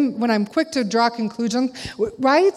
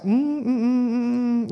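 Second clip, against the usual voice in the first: disapproving hums; slower than usual. Retold or imitated. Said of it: "and I was like".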